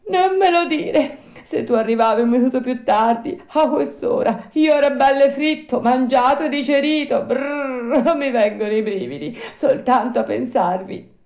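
high, loud puppet voice full of horror; frightened high-pitched outburst; shuddering fear. Acted. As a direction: recoil at the thought, crying it out. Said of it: "che tremava ancora dalla paura".

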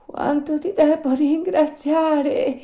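high, drawn-out little puppet voice; very high, loud grateful cry. Acted. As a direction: squeak out heartfelt thanks, slowly. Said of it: "disse il burattino".

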